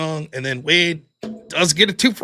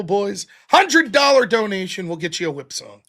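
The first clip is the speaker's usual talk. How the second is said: ordinary talk, nothing marked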